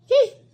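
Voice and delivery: a loud, high indignant hmph; angry huff. Acted. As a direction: snort it off in contempt. Huff ending the quarrel. (गुस्से वाली हुंह)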